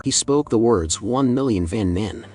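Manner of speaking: ordinary delivery, nothing marked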